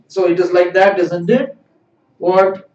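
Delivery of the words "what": ordinary delivery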